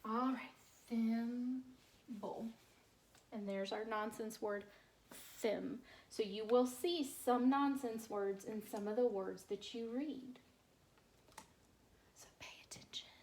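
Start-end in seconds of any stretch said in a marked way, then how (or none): 0.9-2.5 slow, careful thimble; teacher reading slowly; slow drawn word. Shown as a demonstration. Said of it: "slow drawn-out reading". 12.2-13.1 soft whispered reminder; whispered aside to the class. Real, not acted. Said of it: "whispered classroom aside".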